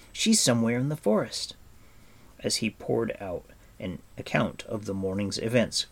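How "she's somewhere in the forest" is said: higher, louder helpless answer; distressed cry. A narrator's reading. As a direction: plead that it's all you know. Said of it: "Taran cried".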